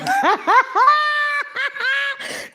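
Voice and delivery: high shrieking burst of laughter. Real, not acted. Laughing at own joke.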